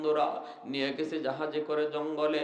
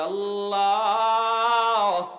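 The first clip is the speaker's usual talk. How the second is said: acted crying out; 4 semitones higher than usual. Mother begging God not to drown her son, acted cry. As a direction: call out long on the first word, then plead. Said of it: "মা এখান থেকে চিৎকার দিয়া কয়".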